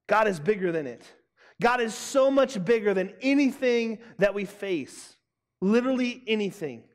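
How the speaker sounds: usual speaking voice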